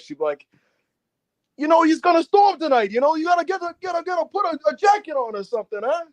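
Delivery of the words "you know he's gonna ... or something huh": put-on high-pitched old Jersey lady accent; Kate McKinnon's Jersey lady. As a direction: fuss like an old New Jersey woman, high and nagging. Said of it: "old Jersey ladies".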